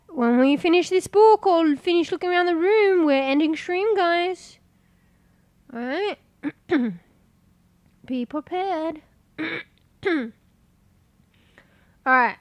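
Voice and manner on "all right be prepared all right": blocked nose, throat clearing; congested. Real, not acted. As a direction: talk through a stuffy nose. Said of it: "Bro, I'm so congested".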